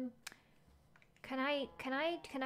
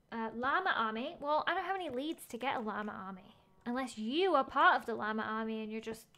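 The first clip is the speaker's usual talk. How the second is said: ordinary delivery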